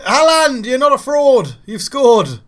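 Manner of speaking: goal celebration. Real, not acted. Gamer screaming with delight as his striker finally scores. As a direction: erupt with joy as the ball goes in.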